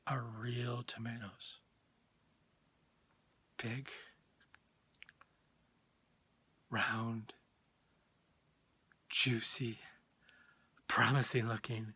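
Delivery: savoring. Acted. Dreamy savoring of garden tomatoes.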